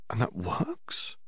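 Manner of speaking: slow, hushed, wondering question; awed whisper. A narrator's reading. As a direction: breathe it in amazement. Hermione amazed, eyes widened.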